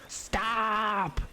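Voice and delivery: high, drawn-out mock yell of "Stop". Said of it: "go up and yell".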